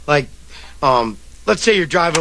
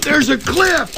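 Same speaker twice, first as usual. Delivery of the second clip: high-pitched mock panic; panicked yell; 9 semitones higher, slower than usual. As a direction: yelp a warning in hammed-up terror. Acting out crash panic.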